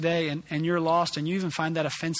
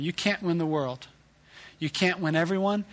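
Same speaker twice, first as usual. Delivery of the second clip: ordinary talk, nothing marked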